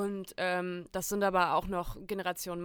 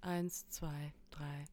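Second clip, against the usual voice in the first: whispered countdown; 4 semitones lower, 8 dB quieter, much slower than usual. Real, not acted. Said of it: "Wollen wir auf drei alle Tschüssi sagen?".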